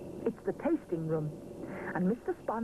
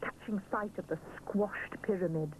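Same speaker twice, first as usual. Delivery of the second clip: usual speaking voice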